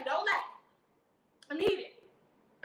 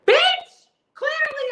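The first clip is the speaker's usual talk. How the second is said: sudden shriek, much higher and louder; exasperated outburst; 12 semitones higher, 10 dB louder, much slower than usual. Real, not acted. Fed up with the operator's advice, exasperated shriek. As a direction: explode on this one word.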